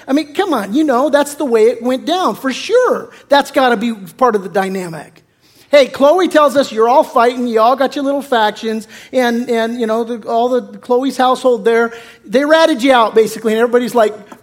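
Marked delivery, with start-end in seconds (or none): none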